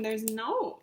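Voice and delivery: usual speaking voice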